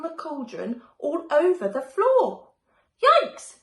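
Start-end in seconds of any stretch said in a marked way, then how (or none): 3.0-3.6 high, loud startled squeak; yikes. A narrator's reading. Betty seeing the brew pour over the floor, startled. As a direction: jump at the mess. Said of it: "Betty said".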